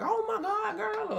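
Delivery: very high, squealing surprise greeting; excited squeal. Pretend. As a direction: squeal it like spotting an old friend.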